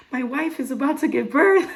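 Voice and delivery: high, loud mock yell down a hallway; imitated scream. Husband calling for absent nurses, mock screaming. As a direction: holler like a frantic husband, playfully exaggerated. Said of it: "he starts screaming into the hallway".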